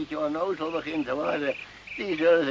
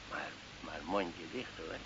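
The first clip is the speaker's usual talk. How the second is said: zacht, samenzweerderig; 9 semitones lower, 14 dB quieter than usual. Acted. Old man hushes friend.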